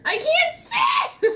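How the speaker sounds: high and slow through laughter; laughing so hard the words stretch. Real, not acted. Laughing after cream in face.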